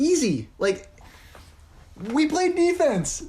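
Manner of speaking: high, fast excited bragging. Real, not acted. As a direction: crow about the win, way up high. Boasting about crushing rivals.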